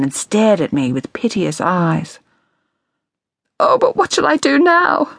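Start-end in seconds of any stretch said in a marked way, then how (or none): 3.6-5.1 much higher, pleading wail; imploring cry. A narrator's reading. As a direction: beg her for comfort, lost. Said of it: "she cried imploringly".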